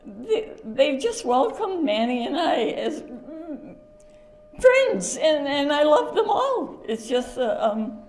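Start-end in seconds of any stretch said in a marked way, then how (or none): 0.0-6.8 higher, breaking with tears and laughter; choked up. Real, not acted. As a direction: fight back tears while you speak. Tearful at a memorial.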